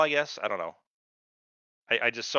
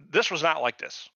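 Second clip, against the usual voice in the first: ordinary talk, nothing marked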